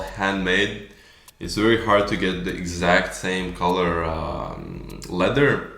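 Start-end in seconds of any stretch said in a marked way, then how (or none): none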